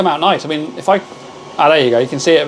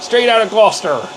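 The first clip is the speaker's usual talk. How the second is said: Straight out of Gloucester in a rural Gloucestershire farmer accent; hammed-up Gloucestershire farmer accent, a bit higher; 4 semitones higher than usual. Shown as a demonstration. Streamer to his chat, in a mock Gloucestershire farmer accent. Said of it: "With a farmer accent".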